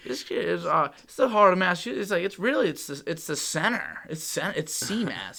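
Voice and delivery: lightly acted, emphatic insistence; insistent friend. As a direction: imitate a friend adamantly correcting you. Mimics friend defending his region.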